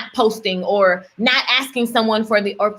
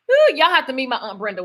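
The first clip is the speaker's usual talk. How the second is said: ordinary talk, nothing marked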